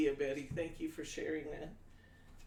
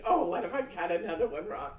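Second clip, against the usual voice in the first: louder, fretting self-talk; gun-shy fret about cutting wrong; mock worried inner voice; 2 semitones higher, 9 dB louder than usual. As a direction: voice your own nervous thought, half-joking. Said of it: "I keep thinking".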